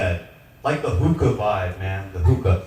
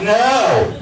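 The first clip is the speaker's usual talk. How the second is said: higher, louder panicked no; 10 semitones higher, 4 dB louder than usual. Retold or imitated. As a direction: cry out no in alarm. Panicked retold cry.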